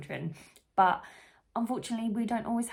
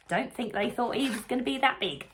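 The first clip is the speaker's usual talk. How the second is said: ordinary delivery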